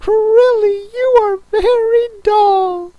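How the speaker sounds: very high, tearful scolding; angry. A narrator's reading. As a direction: whine the reproach in a squeaky turtle voice. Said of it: "said the Mock Turtle angrily".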